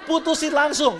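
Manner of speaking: preacher echoing the audience loudly; loud call-out. Real, not acted. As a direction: call it out over the crowd. Repeating audience shout.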